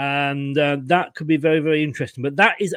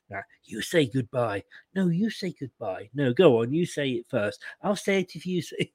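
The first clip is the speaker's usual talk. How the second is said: soft, cooing phone goodbye, much quieter; mock lovers' goodbye; 5 semitones lower, 8 dB quieter than usual. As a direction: tease him as a lovesick boyfriend who won't hang up. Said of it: "still saying goodbye to Chloe on the phone".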